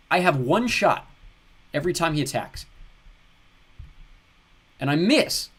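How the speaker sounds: loud, exasperated gamer complaint; frustrated. Real, not acted. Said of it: "enjoying my frustration".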